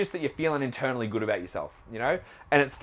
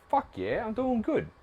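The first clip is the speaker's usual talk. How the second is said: pumped self-talk; 10 semitones higher than usual. Retold or imitated. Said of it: "You're like".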